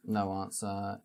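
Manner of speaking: usual speaking voice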